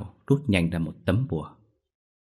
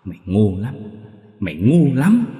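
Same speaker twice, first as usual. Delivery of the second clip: mounting fury; 3 semitones higher, 6 dB louder than usual. Acted. Ghost screaming insults at the mother. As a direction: build from a growl to a scream. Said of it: "lại gằn giọng".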